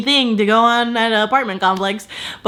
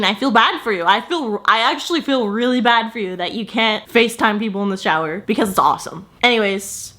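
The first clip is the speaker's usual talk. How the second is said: ordinary talk, nothing marked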